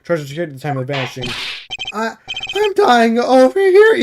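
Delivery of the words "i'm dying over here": much higher, slow, louder whine; meek bailiff whining. Acted. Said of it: "Your voice says that".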